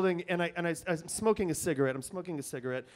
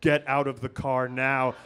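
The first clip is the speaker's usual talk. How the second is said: police megaphone; 6 semitones lower, 4 dB louder than usual. Retold or imitated. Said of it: "police megaphone say".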